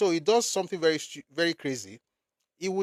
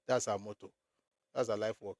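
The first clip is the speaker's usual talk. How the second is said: ordinary talk, nothing marked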